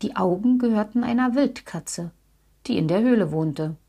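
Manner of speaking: plain reading voice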